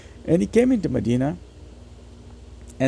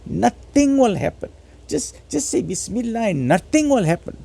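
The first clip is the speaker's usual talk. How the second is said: mock vaccine refuser; 7 semitones higher than usual. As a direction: mimic the know-it-all brushing off medicine. Mocking covid vaccine refusers.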